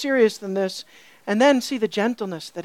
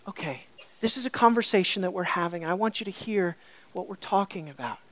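soft, lower conversational voice; gentle tone; 4 semitones lower, 8 dB quieter than usual. Retold or imitated. Preacher voicing the author inviting readers to talk, gently. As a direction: invite them in quietly. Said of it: "it's a gentle tone here".

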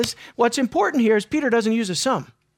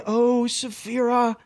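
Peter pleading gently; 3 semitones higher than usual. Retold or imitated. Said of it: "what was going on through his mind".

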